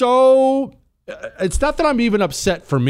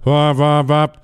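muffled mask mumble; 5 dB louder, slower than usual. Retold or imitated. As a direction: mumble like talking through three masks. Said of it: "She pulls down her mask".

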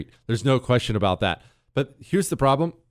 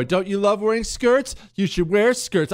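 higher-pitched mock parent coaxing a small child; 7 semitones higher than usual. Parent talking to a three, four-year-old boy, mock coaxing. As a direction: imitate a pushy parent in raised pitch.

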